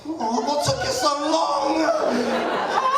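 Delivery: slow, laughing shouted question; laughing at the bouncer mid-fight; laughing mock complaint. Shouting at the late bouncer mid-fight, laughing. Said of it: "I just remember being like".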